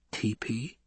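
hushed, soft question; barely voiced query; whisper. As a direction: whisper it in astonishment. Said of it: "the Emperor whispered".

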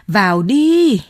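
loud urgent bellow into the dark shelter; woman shouting at a man to come into the bomb shelter, loud. A narrator's reading. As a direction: yell it at him to get inside. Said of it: "bà Kiều Oanh gào lên".

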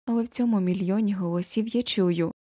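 plain reading voice

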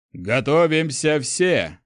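slow, high shouted order; shouting. A narrator's reading. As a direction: shout over the soldiers' voices. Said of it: "крикнул герцог Астрель".

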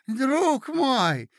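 high, loud exclamation; exclaiming. A narrator's reading. Old prince exclaiming to his nephew Mozglyakov, high. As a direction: burst out with sudden recall. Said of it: "вскричал он".

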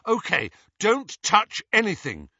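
desperate. Acted. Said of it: "said Harry desperately".

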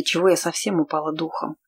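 usual speaking voice

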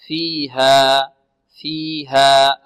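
slow فيها with "ee" and "aa" matched in length; evenly stretched "fee-haa" in فيها; measured equal vowels. Shown as a demonstration. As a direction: give both vowels of فيها two even beats. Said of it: "الميزان ... هو الياء قبلها".

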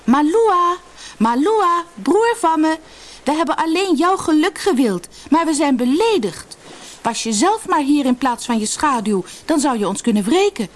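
high, aggrieved cry to someone far off; calling out loud. A narrator's reading. Insulted sister calls her absent brother, loud and high. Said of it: "riep ze luid".

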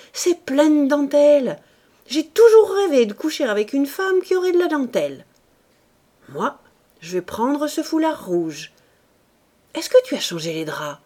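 a lightly voiced husband, loud and eager; husband, greedy. A narrator's reading. Husband rummaging the drawers, eager and loud. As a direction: toss her the nightgown with a leer. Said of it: "dit-il".

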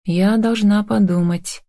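ordinary delivery

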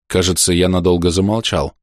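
ordinary delivery, nothing marked

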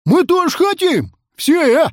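very high, loud yell; insistent outcry; clamoring demand. A narrator's reading. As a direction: shout like a pleading crowd. Crowd clamoring to join.

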